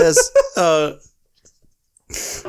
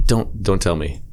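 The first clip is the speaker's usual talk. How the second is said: usual speaking voice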